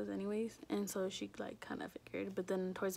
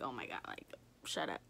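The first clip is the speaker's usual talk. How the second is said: put-on lower, dismissive boyfriend brush-off; a hint of an annoyed boyfriend; 9 semitones lower than usual. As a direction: drop low and wave her off. Voicing boyfriend's dismissive reply.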